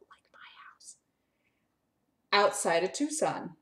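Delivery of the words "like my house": whispered aside. Real, not acted. Aside while reading a story.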